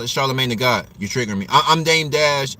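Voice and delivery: normal talking voice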